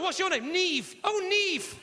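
ordinary talk, nothing marked

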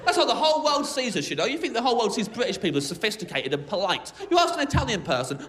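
ordinary talk, nothing marked

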